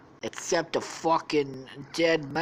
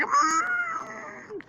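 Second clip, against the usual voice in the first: very high closed-mouth squeal; long, high hummed scream; muffled squeal. Acted. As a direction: squeal as if gagged. Gagged Daphne thrown in lake.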